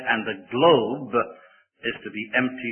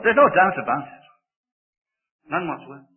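ordinary talk, nothing marked

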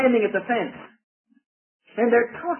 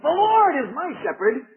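higher, proud brag of a sheep; boastful sheep; 9 semitones higher than usual. Retold or imitated. One sheep boasting to another sheep, proud and higher. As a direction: crow it like a sheep showing off. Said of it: "a boast of a sheep talking".

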